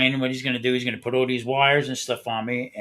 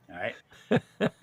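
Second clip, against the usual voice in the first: ordinary talk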